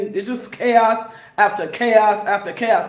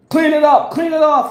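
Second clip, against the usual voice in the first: loud, raised-pitch acted order; angry demand; 4 semitones higher, 4 dB louder than usual. Someone whose sneakers got stepped on, angry demand. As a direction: play someone ordering a clean-up, loudly.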